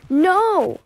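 high, loud no; a desperate shouted no; horrified cry. Acted. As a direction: cry out in protest. Cry of horror.

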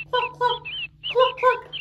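put-on host teaching kids the chicken sound; hen clucking. Shown as a demonstration. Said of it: "what sound do they make".

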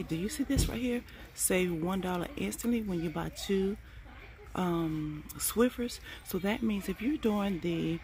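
hushed so shoppers can't overhear; quiet in-store voice. Real, not acted. Shopper filming her coupons in the store, speaking quietly. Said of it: "I do not speak as loud in the store".

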